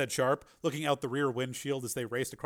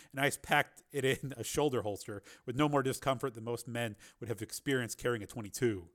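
ordinary delivery, nothing marked

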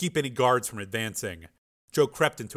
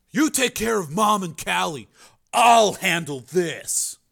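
raised, higher-pitched take-charge voice; urgent Joe; 7 semitones higher, 4 dB louder than usual. Acted. Joe sends Frank to guard the family, urgent. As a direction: give your brother firm orders.

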